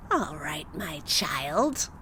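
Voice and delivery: low, crooning witch voice; old witch voice. Acted. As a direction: purr it like a crafty old witch. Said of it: "cried the witch".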